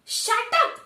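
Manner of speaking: loud high roar of shut up. Acted. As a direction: roar it at her. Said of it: "Ajith roars out".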